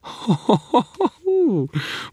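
high ringing head-back laugh; faerie laugh. A narrator's reading.

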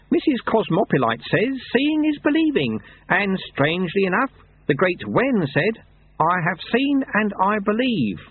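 a hint of a high, mild old monk; lightly voiced old monk. Lutze quotes scripture to Lobsang, high mild old monk.

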